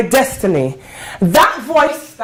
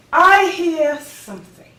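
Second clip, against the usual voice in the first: high, strong, ringing declaration; lifted, emphatic certainty; rising conviction; 3 semitones higher than usual. Acted. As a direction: announce the discovery with conviction. Surer of the new voice.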